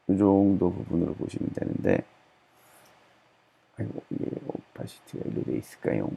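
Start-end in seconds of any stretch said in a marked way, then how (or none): 3.8-6.1 작게 속삭이듯 혼자 중얼거림; 화면 보며 혼자 낮게 속삭이기; 혼잣말 속삭임. Real, not acted. Muttering to self while editing. (편집하다 막혀서 혼자 속삭이는 혼잣말)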